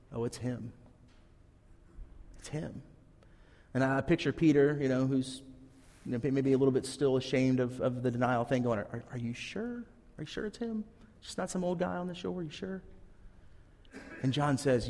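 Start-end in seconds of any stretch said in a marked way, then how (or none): 0.1-2.8 hushed realization. Retold or imitated. John leans over to Peter. 8.8-12.8 hushed nervous doubt. Retold or imitated. Ashamed Peter asking John.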